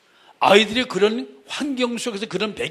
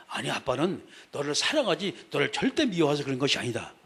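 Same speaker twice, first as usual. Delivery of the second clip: reassuring mother; 5 semitones lower, 5 dB quieter than usual. Retold or imitated.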